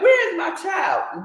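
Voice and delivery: mother shouting for her stolen child, a retold frantic yell. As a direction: yell it like a panicked mother hunting for her baby. Retelling a mother's frantic cry.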